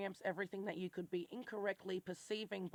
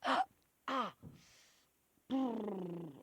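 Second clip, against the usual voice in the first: gasp and shudder; 2 semitones higher, much slower than usual. Real, not acted. Gasping shudder at the thought.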